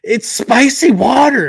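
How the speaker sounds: put-on high, slow little boy complaining about the taste; young boy. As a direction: pipe it up high and drawn out like a small kid grimacing. He's like.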